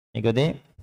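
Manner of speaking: ordinary talk, nothing marked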